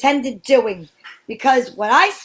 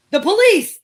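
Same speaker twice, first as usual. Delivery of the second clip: shout; 6 semitones higher, 4 dB louder than usual. Acted. Officer shouting.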